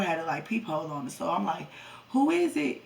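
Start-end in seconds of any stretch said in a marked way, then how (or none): 2.1-2.8 high loud call through the door. Retold or imitated. Calling through the door at a late-night knock, high and loud. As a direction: shout it at the closed door.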